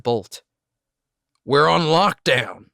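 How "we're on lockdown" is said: slow, loud angry repeat. A narrator's reading. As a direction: bite it out angrily. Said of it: "he said again, angrily".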